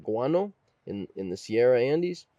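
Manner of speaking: ordinary talk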